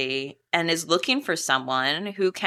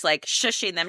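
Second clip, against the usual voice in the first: ordinary talk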